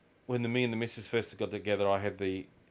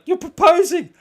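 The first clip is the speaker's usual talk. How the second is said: shrieking it much higher and louder as a mock girlfriend; excited girlfriend; 13 dB louder than usual. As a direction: squeal it like she just saw a ring. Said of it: "She's like".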